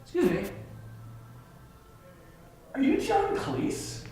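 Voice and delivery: high, soft, hesitant question; tiny high-pitched voice, drawn out; tentative approach. Retold or imitated. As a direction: imitate a timid fan in a small high voice. Retold timid approach to a star.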